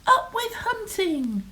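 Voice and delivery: high falling slogan shout; storybook rallying cry, pitched high; shouted cheer. A narrator's reading. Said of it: "And they shouted".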